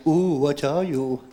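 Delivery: low, slow, drawn-out question; demonstrated catchphrase. Shown as a demonstration. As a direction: show them the line, low and slow. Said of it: "You gotta be scared".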